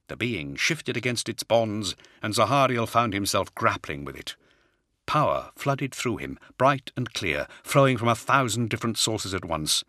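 ordinary delivery, nothing marked

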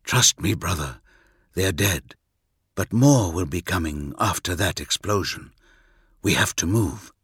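deep, steady soldier; calm low commanding voice; low gruff warrior voice. Acted. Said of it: "said Corax".

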